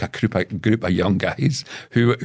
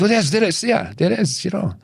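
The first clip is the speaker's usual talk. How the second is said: higher, light mock voice of the driver; imitating the driver; 10 semitones higher than usual. The driver announcing the hotel, a higher put-on voice. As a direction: pipe up like the cheerful driver. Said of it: "And they said".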